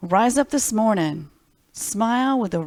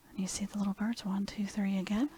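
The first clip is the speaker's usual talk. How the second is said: whispered counting; 12 dB quieter, faster than usual. Acted. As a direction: whisper the count as if sharing a secret. Counting birds softly to children.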